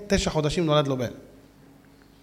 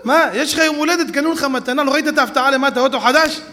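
surprised; 6 semitones higher, 5 dB louder than usual. Acted. Wife reveals the surprise gift.

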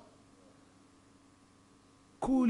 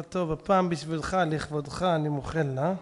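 lower, softer measured pardon; calm magnanimous rabbi; 6 semitones lower, 4 dB quieter than usual. Acted. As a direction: grant forgiveness quietly, as a favour. Rabbi calmly grants forgiveness. (מחילה שקטה בקול נמוך)

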